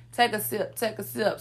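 murmured sip-tea aside; soft knowing tea-sipping mutter; quiet aside. Real, not acted.

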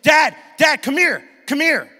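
high, loud shout across the house; son yelling. Retold or imitated. Oldest son shouting for his dad to see a meme. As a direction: call Dad with false urgency. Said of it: "Chase, like".

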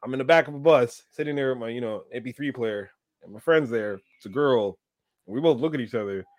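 ordinary talk, nothing marked

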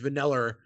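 "vanilla" as va-NELL-er, hard R; harder final R. Retold or imitated. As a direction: lean hard on an added R in "vanilla". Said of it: "it's harder here".

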